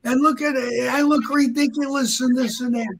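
high-pitched mock rant; scripted tirade. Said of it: "like he read it off a script".

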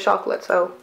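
talking between bites; chewing. Real, not acted. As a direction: mouth partly full. Seen 2: Bites biscuit before, still chewing with biscuit in hand while speaking.

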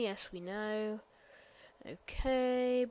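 usual speaking voice

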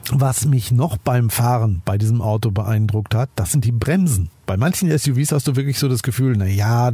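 ordinary talk

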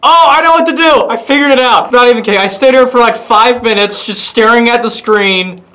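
high, loud burst of triumph; excited realization. Real, not acted. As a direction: blurt out the sudden breakthrough. Suddenly solves the game puzzle.

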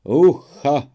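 high, loud festive shout of ucha; joyful whoop. Acted. Wedding guests cheering as fear passes, loud whoop. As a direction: let out a glad wedding cry. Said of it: "ozwały się radosne".

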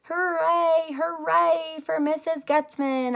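high, loud, drawn-out hooray; shouted cheer. Acted. Junie B cheering the snack lady, shouting slow hoorays. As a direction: cheer the cookie lady at the top of your voice. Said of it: "I shouted at her".

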